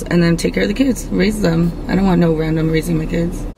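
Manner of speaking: ordinary talk, nothing marked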